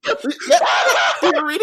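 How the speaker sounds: shrieking, laughing comedian impression; Ricky Gervais. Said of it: "Then he had my Ricky Gervais".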